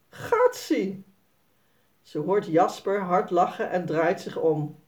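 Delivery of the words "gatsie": disgusted cry. A narrator's reading.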